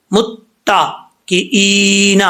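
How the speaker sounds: متکئین drawn out very slowly; slow demo. Shown as a demonstration. Teacher models متکئین very slowly. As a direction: stretch متکئین, long ee. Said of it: "شد نہیں پڑھی جائے گی".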